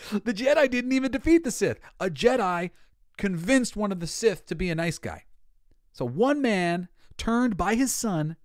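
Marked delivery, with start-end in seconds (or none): 0.1-4.5 laughing. Real, not acted. Laughing at Jedi failure.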